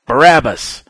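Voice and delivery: loud, high shout of a crowd; Pilate's crowd bellowing Barabbas. Acted. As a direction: yell the name back at the governor.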